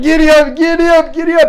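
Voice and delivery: high, shouted, repeated urging; jockey urging. Retold or imitated. Boy riding friend toward the hill, frantic shouting. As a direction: spur your mount on at top voice. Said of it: "Joel's like".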